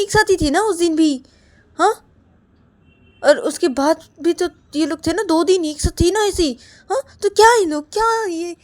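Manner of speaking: loud, high, heated protest to his mother; angry protest. Acted. Son loudly protesting to his mother about the sisters. Vikas arguing angrily with mother.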